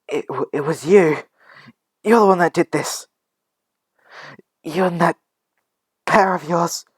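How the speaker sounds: high, loud outraged blame; shocked accusation. Acted. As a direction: accuse the culprit in pained fury. Injured caregiver accuses the boy.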